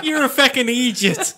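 hammed-up Irish teasing; mock Irish accent. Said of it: "our non-existent Irish contingent".

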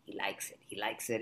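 low, soft confidential aside; hushed approval. Retold or imitated. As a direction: imitate quietly passing on good news. Herbie's confidential approval, retold.

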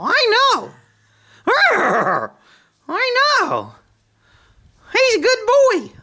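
put-on very high, slow sing-song baby voice for the horse; pet baby talk.

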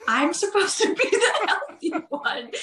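laughing through the words; amused, half-laughing complaint. Real, not acted. Teasing her healthy partner.

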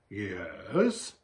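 low, soft, uncertain yes; hesitant, doubting tone; doubtful. A narrator's reading. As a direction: agree with reservations. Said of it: "Edmund doubtfully".

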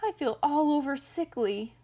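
high wail of self-pity; hammed-up pitiful complaint; crying out. A narrator's reading. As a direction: cry out as a miserable sick bear. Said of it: "cried the bear".

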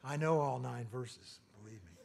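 ordinary talk

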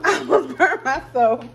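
laughing. Real, not acted. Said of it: "that's what I get for faking".

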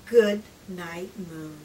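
plain reading voice

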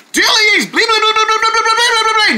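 high squeaky pleading babble; begging bug voice. Acted. Bug begs the Deerlings to let him in, high babbling plea. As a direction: beg in a high pipsqueak gabble. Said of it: "We go like".

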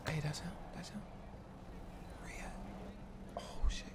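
very quiet, under the breath; low-volume stakeout murmur; hushed lookout. Pretend. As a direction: spot the target and keep your voice way down. Spotting target, hushed plotting.

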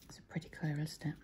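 soft admiring whisper. Real, not acted. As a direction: whisper it like a secret. Admiring colour going on.

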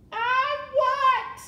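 very high, loud wail; crying out. A narrator's reading. Mig crying out in the dungeon, high wail. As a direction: cry it out at full pitch. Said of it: "cried Mig".

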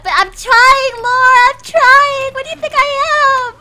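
frantic shouting. Real, not acted. Streamer shouting at Lara that she is trying, frantic. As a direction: yell back defensively.

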